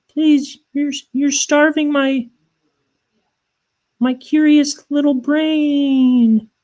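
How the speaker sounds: very high put-on child voice; hammed-up pleading, dragging out the last words slowly; whiny begging kid. Kids begging uncle for YouTube, whiny mock-child pleading.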